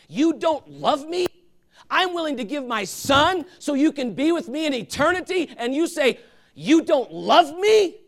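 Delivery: put-on God asking his children why they doubt; voice of God, wounded. Preacher voices God, hurt.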